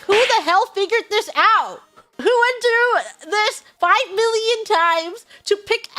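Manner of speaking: high, exasperated disbelief; incredulous outburst. Acted. Reacting to the secret rock guide, incredulous outburst. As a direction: demand an answer in loud disbelief.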